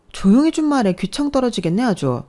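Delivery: irritated snap. Acted. Elder sister-in-law at the spa snapping at the caller, high and loud. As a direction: bite back at the caller for being loud.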